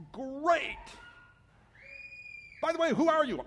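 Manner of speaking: high, loud, relieved call upward; climber calling up. Acted. Climber hanging on a branch calling up to the stranger, high and loud. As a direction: shout up, eager and hopeful.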